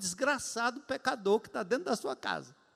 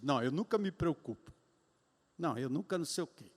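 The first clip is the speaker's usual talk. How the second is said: softer mock of a naive believer; mocking naive optimist; 7 semitones lower, 7 dB quieter, faster than usual. Preacher mocking a naive believer, softer and dismissive. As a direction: lightly parody someone in denial. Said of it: "alienado falando coisas positivas".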